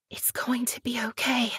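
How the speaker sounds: soft unvoiced whisper; hushed reassuring whisper. A narrator's reading. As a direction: breathe it into his cheek. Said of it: "she whispered".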